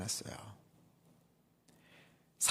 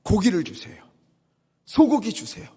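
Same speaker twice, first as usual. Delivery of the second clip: begging, building from plain to loud and high; whining demand; 8 semitones higher, 7 dB louder than usual. Retold or imitated. Israelites nagging Moses for meat, building whiny demand. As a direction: pester louder with each meat named. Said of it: "떼를 쓰며 울었어요".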